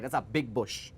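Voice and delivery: ordinary delivery, nothing marked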